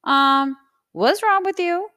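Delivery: put-on high, loud, blunt kid voice; imitating her kids. Kids confronting their mother to her face, in a high loud child voice. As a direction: go high and call mom out like a child. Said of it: "look at me in my face and say".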